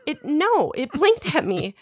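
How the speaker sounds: stubborn mock protest, voice lifted; insistent denial. Retold insisting against disbelief.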